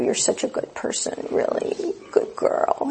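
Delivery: soft whispered encouragement; gentle whispered affirmation; whispered praise. Retold or imitated. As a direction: whisper kind words to her. Whispered affirmations during practice.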